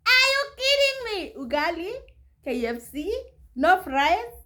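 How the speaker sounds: high-pitched incredulous questioning; hammed-up indignant questions; mock outraged disbelief. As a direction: act out disbelief, starting very high. Retelling outraged reaction to ugali offer.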